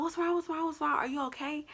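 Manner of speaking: worried friend. Retold or imitated. Friend answers a scared FaceTime call, high-pitched and worried. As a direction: rattle off concern as the friend on the phone.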